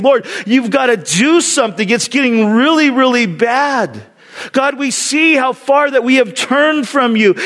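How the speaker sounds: high, earnest appeal; crying out. Retold or imitated. Believers praying over their world, crying out. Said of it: "And we cry out".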